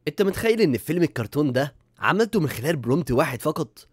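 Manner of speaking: normal talking voice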